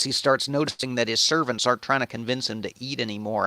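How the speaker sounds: ordinary talk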